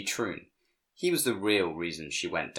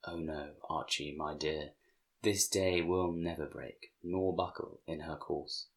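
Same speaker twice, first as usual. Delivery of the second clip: whispered; 2 semitones lower, 8 dB quieter than usual. A narrator's reading. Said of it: "and whisper back".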